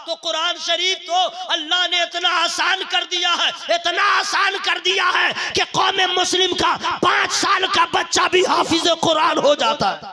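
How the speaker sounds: loud ringing sermon, words stretched; preaching at full force. Acted. Preacher to the congregation, booming full-force preaching. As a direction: thunder it out to the crowd.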